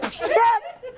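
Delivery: hammed-up high excited sister; excited agreement. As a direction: mimic her joyful agreeing. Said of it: "She's like".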